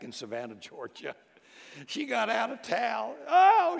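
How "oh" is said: mock wailing. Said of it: "she wailed".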